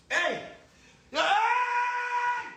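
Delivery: very high crying shout; real crying cry; anguished yell. Real, not acted. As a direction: howl it out in distress. Outburst mid rant.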